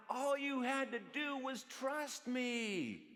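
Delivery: high, stretched-out holler; retold holler. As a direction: holler it slowly, every word drawn out. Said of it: "And then I hollered out".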